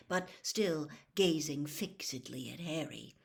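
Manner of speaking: usual speaking voice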